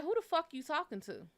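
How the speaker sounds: retelling her furious reply; angry retort. Retold or imitated. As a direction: snap back at him, offended.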